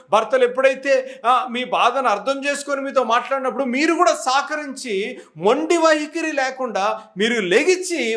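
fervent preaching. Acted. Pastor urging wives in the congregation, fervent preaching. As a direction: press the point home to the congregation.

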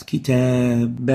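كتابا said slowly, ending in a clear nun sound: kitaban; kitaban with added nun. Shown as a demonstration. A teacher slowly showing students the tanween on كتابا. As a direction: draw out كتابا so the final nun is heard. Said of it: "وأضفنا إلى آخرها حرف نون ساكن لأصبحت".

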